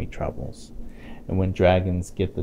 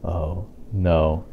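plain reading voice

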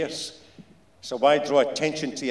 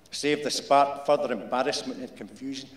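usual speaking voice

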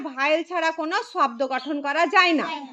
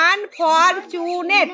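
slow, split into আন-ফর-চু-নেট; syllable by syllable; 4 dB louder, much slower than usual. Shown as a demonstration. Teacher counting out syllables for the class, syllable by syllable. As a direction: stretch each syllable of unfortunate. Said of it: "চু একটা, নেট একটা".

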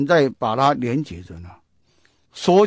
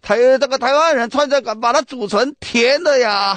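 mock yelling; 6 semitones higher than usual. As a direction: imitate the tourists shouting in protest. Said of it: "大家都吼， 吼叫起来了". (模仿众人吼叫抗议)

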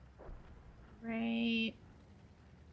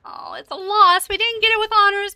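excited reaction; 5 semitones higher, 8 dB louder than usual. Real, not acted. Sees the match is lost.

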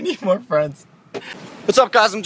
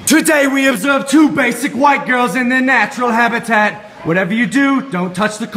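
wildlife narrator voice; nature documentary; 2 semitones higher than usual. Acted.

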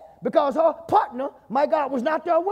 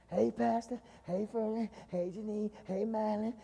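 mock greetings; 12 dB quieter than usual. Mock cheerful church greetings.

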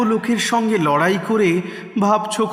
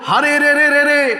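bandits' yell; 9 semitones higher, 5 dB louder than usual. A narrator's reading. Bandits leaping up at the boy's threat, loud high yell. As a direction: yell it like charging raiders, holding the pitch up. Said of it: "চেঁচিয়ে উঠল".

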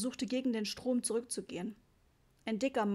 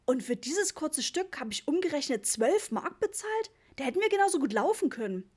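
raised, higher voice of a dad protesting; irritated and incredulous; indignant complaint; 5 semitones higher, 4 dB louder than usual. A narrator's reading. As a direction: grumble in disbelief at being overcharged. Papa grumbles about the fare.

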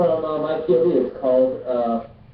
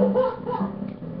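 breathy high laugh; 4 dB quieter than usual. Real, not acted. Laughing at fic.

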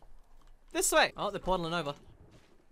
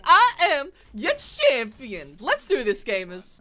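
announcer hero voice; 2 semitones higher, 6 dB louder, slower than usual. Retold or imitated. Character voice line imitation.

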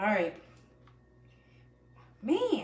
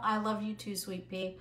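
quiet aside; 3 semitones lower, 7 dB quieter than usual. Real, not acted. Aside to family off camera.

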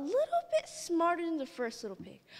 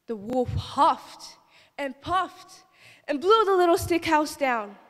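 building narration; 3 semitones higher, 8 dB louder than usual. A narrator's reading.

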